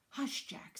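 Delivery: unvoiced, hissed shushing; sharp hush. Babysitter silencing Jack, hissed hush. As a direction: shush him in a near whisper.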